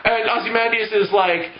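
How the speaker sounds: normal talking voice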